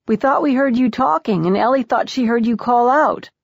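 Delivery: high-pitched called-out explanation; calling through door. A narrator's reading. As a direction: project it through a closed door. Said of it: "he calls through the door".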